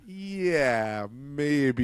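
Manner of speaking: drawn-out, noncommittal brush-off; imitating an awkward polite stall; mock reluctance. Voicing reluctant replies to Elon.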